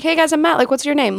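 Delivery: lightly voiced laid-back celebrity greeting; chill guy imitation. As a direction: play it super chill and friendly. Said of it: "he was just like".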